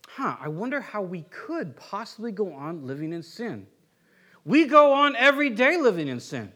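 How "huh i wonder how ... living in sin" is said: softer, lower mock wondering; musing to himself. Paul supposedly asking himself about sin, musing to himself. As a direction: ponder it quietly as if to yourself. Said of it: "saying to himself".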